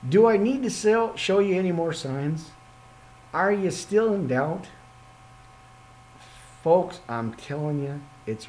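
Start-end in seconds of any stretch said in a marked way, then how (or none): 0.1-4.7 lightly voiced Jesus, higher, pressing them; a hint of Jesus asking if they need more signs; mild mock exasperation in the voice of Jesus; Jesus challenging doubters. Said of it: "He's standing there poking me, going".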